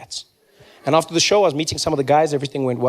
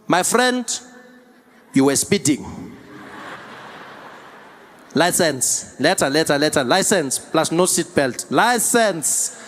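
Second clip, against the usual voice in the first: put-on blunt South African cop accent; South African police officer; slower than usual. Metro Police at pulled-over driver, South African officer accent. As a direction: list demands in a heavy South African accent. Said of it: "the Metro Police comes there".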